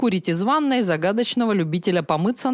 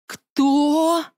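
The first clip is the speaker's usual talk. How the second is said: high, surprised squeak; startled; 8 semitones higher than usual. Acted. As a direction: blurt it out in surprise. Startled question.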